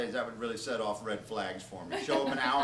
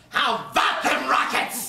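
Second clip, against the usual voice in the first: high, loud, teeth bared; lipless gnash; 11 semitones higher, 8 dB louder than usual. Retold or imitated. Jumping from the buffet to scare a guest, high loud gnash. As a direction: leap out and gnash it at him. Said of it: "I gnash out a lipless diction phrase".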